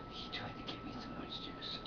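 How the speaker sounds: soft whispered aside; quick hushed aside. Real, not acted.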